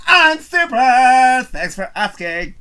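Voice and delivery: loud, high, slow chipper answer; mock chipper reply. Fake movie character answering cheerfully amid chaos, loud and slow. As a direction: beam it out like nothing's wrong.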